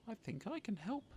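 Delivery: small snail voice. Acted. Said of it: "said a small squirrely snail".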